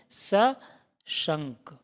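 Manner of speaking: सशंक stretched out very slowly; teacher stretching सशंक for students; very slow model word. Shown as a demonstration. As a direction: draw out सशंक syllable by syllable. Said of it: "ऐसे भी सही कर सकते हैं".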